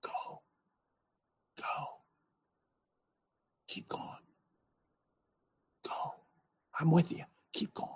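whispered go on, barely audible; small still voice. Acted. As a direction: breathe it like the inner voice urging on. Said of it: "That small, still voice".

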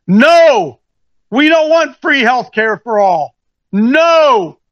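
hammed-up Republican saying no; mock Republicans.

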